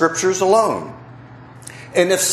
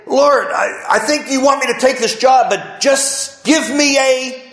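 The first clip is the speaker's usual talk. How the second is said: praying believer; 9 semitones higher than usual. Retold or imitated. Preacher imitates believers praying to God, lightly pleading. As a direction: ask God for a sign like an eager believer. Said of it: "did you ever hear people today say".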